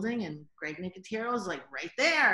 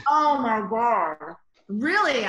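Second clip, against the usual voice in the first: loud, slow oh my God; slow, loud, awed exclamation; overwhelmed; 3 semitones higher, 9 dB louder, slower than usual. Real, not acted. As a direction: stretch it out, overwhelmed. Reliving pressure of the job.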